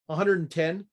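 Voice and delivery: ordinary delivery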